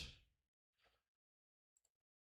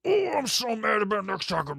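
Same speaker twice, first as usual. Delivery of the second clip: mock mad shout; 7 semitones higher than usual. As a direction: fake a big outburst about Nux Taku.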